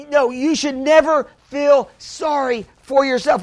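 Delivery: ordinary delivery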